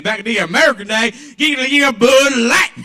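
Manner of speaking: loud, high Southern grandpa twang; hammed-up Southern drinker insisting. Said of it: "love the twang of the voice".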